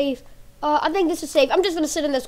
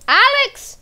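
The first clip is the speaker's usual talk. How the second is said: high loud calls into the dark; calling out; 11 semitones higher, 7 dB louder, much slower than usual. Pretend. Kid searching for lost Alex, calling loudly. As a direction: call out for Alex.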